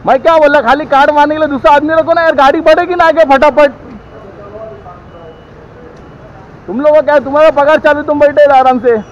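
heated, high-pitched scolding; angry complaint. Real, not acted. Real argument with petrol pump staff.

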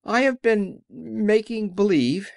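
high, slow little-man voice; meek confession. A narrator's reading. The little man confesses to the friends, high and slow. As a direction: draw it out high, owning up.

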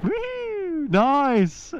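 high thrilled woo; excited whoop. Real, not acted. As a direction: let out a happy whoop. Thrilled riding down slope.

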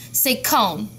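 ordinary talk, nothing marked

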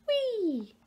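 high, loud squeal down a slide; gleeful whee. A narrator's reading. Little fish slides down the waterfall, squealing whee. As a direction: let out a high storybook whee.

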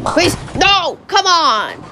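high-pitched exasperated pleading; frustrated plea. Real, not acted. Player begging the pins to fall, frustrated plea. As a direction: plead with the game as the shot fails.

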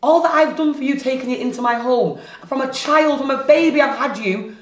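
worked-up parental guilt trip; indignant, emphatic and wounded; hurt reproach. Acted. Parent reproaching Marley after the theft.

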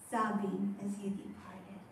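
sad whispered repeat; whispered echo. Acted. Mother whispering as her son leaves. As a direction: breathe the words out as a whisper.

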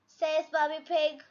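plain reading voice

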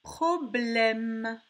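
"Problème" loud, in stretched syllables; slow "Problème" with a held m; word sounded out. Shown as a demonstration. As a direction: project pro, then blème in "Problème". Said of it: "on entend le son M".

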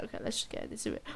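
unvoiced, under the breath; whispered aside. Real, not acted. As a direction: drop to a whisper to yourself. Muttered aside.

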